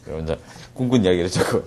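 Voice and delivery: ordinary talk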